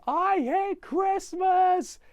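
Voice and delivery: very high, loud, slow furious yell; very high drawn-out kid's scream; hammed-up shout. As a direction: roar it slowly with all his might. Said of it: "picks up the tree above his head and goes".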